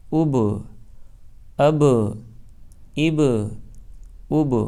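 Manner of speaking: اب said with the ب bouncing off its point; bouncing qalqala. Shown as a demonstration. Teacher drilling students: اب with bouncing qalqala. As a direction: let the ب in اب rebound with an echo. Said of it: "قلقلہ کے مخرج پر لگ کر اچڑ جائے گی".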